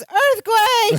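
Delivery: high-pitched sudden alarm cry; imitated panicked scream. Balki waking in a shaking bunk, screaming in mock panic. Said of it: "Balki suddenly screams".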